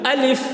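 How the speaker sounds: الف with a breathy release of air at the end; الف as a clean demo with an airy finish; correct alif. Shown as a demonstration. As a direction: say الف letting the air flow out. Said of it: "یہ صحیح تلفظ ہے الف کا".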